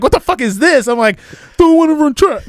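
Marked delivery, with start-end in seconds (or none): 0.0-0.9 high, loud exasperated mom yell. Retold or imitated. Mom yelling at her son over the track letter, high and loud. 1.6-2.4 high, loud whiny protest; whiny kid. Retold or imitated. Son protesting to his mom, high whiny whine.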